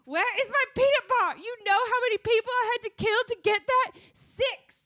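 very high, loud, frantic screech; hammed-up furious warlord at the top of the voice; Severo. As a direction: yell in outrage, climbing to a shout. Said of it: "[name]'s gonna be our Severo for this".

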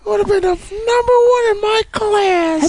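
high whining falsetto lament; slow, high, crying mock whine; whiny falsetto. Mocking whiny genius.

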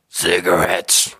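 slow, rasping mock smoker's voice; lightly voiced gravel of a chain smoker; lung cancer deep voice. The chef explains his deep voice, slow mock smoker's rasp. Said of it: "my impersonation of him there".

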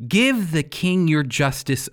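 ordinary talk

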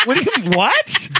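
imitated incredulous reaction; stunned disbelief. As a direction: stammer out a retold double take with a laugh. Acts out his stunned reply.

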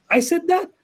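imitating a thrilled, high-pitched question; excited brother. Brother reacting to hearing himself quoted, excited. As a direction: pitch leaps up in mock disbelief and excitement. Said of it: "he was so excited".

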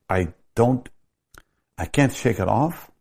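ordinary delivery

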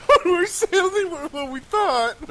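high, slow, laughing mock lament; mock sad announcer. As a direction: wail it like it's a tragedy. Co-host mocks the sad announcement.

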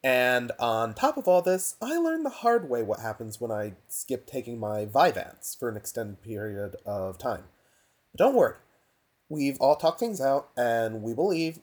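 tinny laptop microphone; recorded on a cheap laptop mic. Real, not acted. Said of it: "so sorry for the laptop mic".

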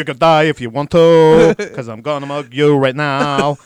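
a hint of a mugging cab driver. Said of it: "said it like a cab driver".

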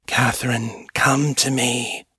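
slow, hushed beckoning; whispering lure. A narrator's reading. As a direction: draw her in with a drawn-out call. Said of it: "whispered the voice behind the door".

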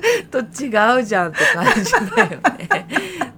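high, loud, bursting with laughter; laughing agreement. Real, not acted. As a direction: agree rapidly through helpless high laughter.

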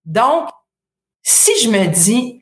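ordinary talk

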